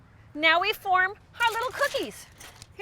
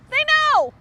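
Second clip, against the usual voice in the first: sudden paranoid cry; 8 semitones higher, 6 dB louder than usual. Acted. Paranoid repeats about the ducks.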